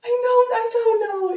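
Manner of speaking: high, loud, baffled protest; real bafflement. Real, not acted. Telling a friend about the date's absurd line, high baffled exclamation. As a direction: raise the pitch right up in disbelief. Baffled reply to listener's disbelief.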